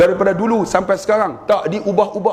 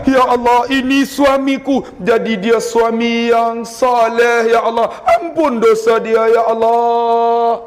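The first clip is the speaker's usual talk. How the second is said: higher, slow, pleading wife's voice; wife's prayer; 6 semitones higher, slower than usual. Retold or imitated. Preacher voicing a wife praying for her husband, high and slow. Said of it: "Isteri pula".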